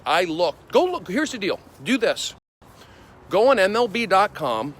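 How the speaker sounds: pumped, selling the idea hard; excited. Real, not acted. Hyped rant about his friend.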